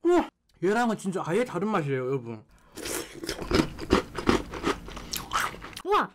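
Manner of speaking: chewing while talking; speaking around food; mouth full. Real, not acted. Seen 4: Takes a spoonful at 2.5, chews with cheeks full throughout.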